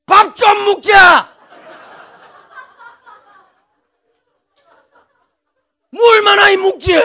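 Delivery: hammed-up angry father shouting at the table; father yelling. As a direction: bellow like an old man fed up at dinner. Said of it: "소리를 질러요".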